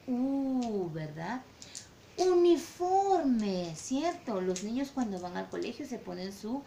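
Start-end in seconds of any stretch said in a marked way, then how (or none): 2.2-3.7 very slow, high, loud "Uniforme" with a long U; stretched teaching word. Shown as a demonstration. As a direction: sing it out slowly so kids hear the U. Teacher showing word for vowel U. ("Uniforme" muy lento, agudo y fuerte)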